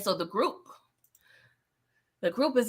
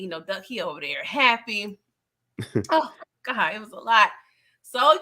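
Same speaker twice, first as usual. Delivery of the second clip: ordinary talk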